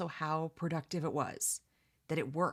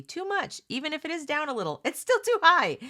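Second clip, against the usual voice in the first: much higher and louder, fast and indignant; indignant outburst; 11 semitones higher, 8 dB louder than usual. Real, not acted. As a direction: let the frustration spike. Indignant about teen pregnancy.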